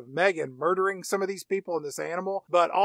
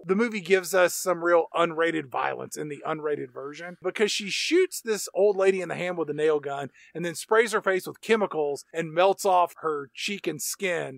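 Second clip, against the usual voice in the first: ordinary delivery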